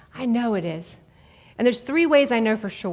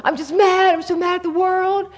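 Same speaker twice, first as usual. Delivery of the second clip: higher, louder mock whine of an angry teen; angry teenage son; 8 semitones higher, 3 dB louder than usual. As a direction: voice the furious eighteen-year-old, pouty and high. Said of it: "and he's like".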